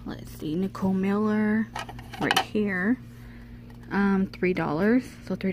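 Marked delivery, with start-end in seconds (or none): none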